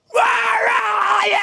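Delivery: long, very high reenacted scream; huge roar. Man in group stood up and went off, huge roar. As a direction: let out a drawn-out, trailer-shaking howl. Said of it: "Shook the trailers".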